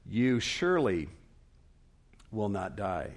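measured emphatic denial, broken after "surely". Acted. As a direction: stress "surely", then a long pause before "will not die". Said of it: "he's not afraid to be emphatic here".

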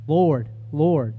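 emphatic declaring. Retold or imitated. Preacher voicing churchgoers who claim the Lord, emphatic. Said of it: "They're emphatically declaring".

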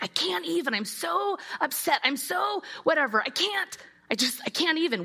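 mock someone at the end of their rope; exasperated whine. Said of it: "our can't even moments".

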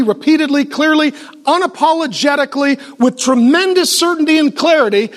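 ordinary talk

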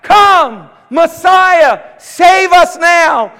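high, loud pleading cry; crowd crying out. Retold or imitated. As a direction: beg the Messiah to come now. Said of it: "They're saying".

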